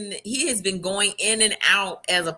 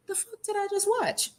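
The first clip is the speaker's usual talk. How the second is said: high, softer stunned question; acted disbelief; 12 semitones higher, 6 dB quieter than usual. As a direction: play a dumbfounded viewer, pitched way up. Acting out stunned viewer reaction.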